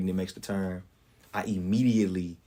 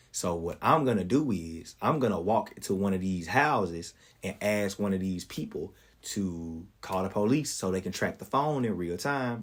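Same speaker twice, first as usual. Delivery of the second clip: normal talking voice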